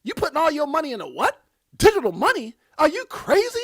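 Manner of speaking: mock-disbelief at putting money in Digital money; incredulous family member. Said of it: "called you crazy".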